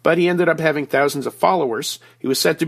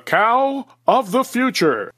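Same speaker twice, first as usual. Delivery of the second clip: hammed-up radio announcer, pitched up; best radio voice; 8 semitones higher, slower than usual. Guest unveils dairy industry project to the host, mock radio voice. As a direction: announce it in a grand put-on radio voice. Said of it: "here I go in my best radio voice".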